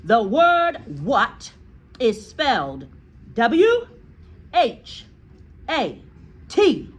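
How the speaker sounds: slow, loud spelled-out shout; loud bullying shout, letter by letter. A narrator's reading. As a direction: hammer each letter out. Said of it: "The Trunchbull was shouting".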